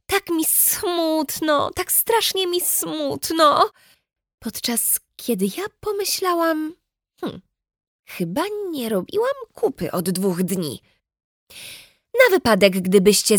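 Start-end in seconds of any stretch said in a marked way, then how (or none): 0.1-3.7 high, slow, mopey lament; exaggerated mock sorrow; mock sad whine. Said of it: "powiedziała mi to z milion razy". 4.4-10.8 softer, deadpan thought; a hushed deadpan aside; quiet inner aside. Acted.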